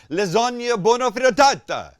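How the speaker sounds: loud, high mock Italian accent; exaggerated Italian accent. Host spoofing TV cook's Italian words, exaggerated Italian accent. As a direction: burst into hammed-up Italian on the food words. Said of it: "The Italian accent only comes out".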